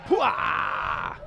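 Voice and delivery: loud wordless "pwah" burst of excitement; sudden booming exclamation; explosive shout. Real, not acted. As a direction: blurt out a big whoop as the dart lands. Commentator reacts to winning dart.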